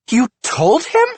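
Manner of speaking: high, loud incredulous outburst. A narrator's reading. Wife incredulous husband told police. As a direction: fire it in disbelief.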